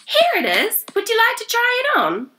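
put-on high, eager young woman voice; a bright helpful shop girl; cheery shop assistant. A narrator's reading.

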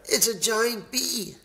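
high-pitched squeaky panic; high mock-terrified storybook voice; frightened squeal. A narrator's reading. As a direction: squeal it like a scared bear. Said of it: "squealed the bear".

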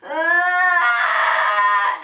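loud, high scratchy ah; a gritty fry sound; vocal fry scratch. Shown as a demonstration. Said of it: "and then you get that scratch".

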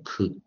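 "could, would" with no L sound; silent L. Shown as a demonstration. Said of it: "L都没发音".

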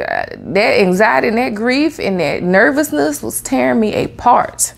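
ordinary talk, nothing marked

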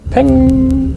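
high sharp peng; mock gunshot. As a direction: pop it out like a shot. Imitiert einen Schuss. (imitiertes Schussgeräusch)

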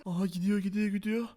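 soft, low commentary; furtive aside. Acted. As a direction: keep it down so the friend won't hear. Aside as Tarık walks off.

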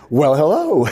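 high, loud mock-surprised hello; imitated surprised greeting. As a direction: mimic someone pleasantly taken aback. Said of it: "as if I'm a surprise".

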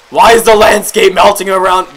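loud, high exasperated question; baffled yell. Real, not acted. Player confused by melting landscape, baffled yell. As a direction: demand an explanation at top volume.

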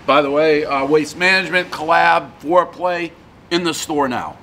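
chewing while talking; speaking between bites; mouth full of pizza. Real, not acted. Seen 0: Bit slice at -3, mouth moving around food while speaking.

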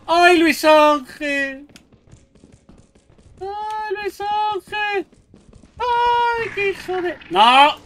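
slow high panicked wail; drawn-out wail. Real, not acted. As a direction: stretch out the cry in panic.

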